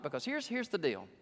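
ordinary talk, nothing marked

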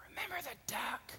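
whispered blackmail; menacing whisper. Acted. Sister reminds Johnny of his secret, menacing whisper. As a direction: hush it as a secret threat.

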